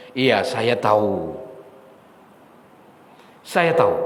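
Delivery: unhurried, letting each admission settle kindly; gentle, loving. Retold or imitated. Allah reassures His servant that the sins stayed hidden, gently. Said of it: "kata-kata yang sangat lembut dan penuh kasih sayang".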